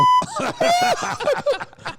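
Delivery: high, loud squealing laugh; shrieking laugh. Real, not acted. Shrieking laugh after name.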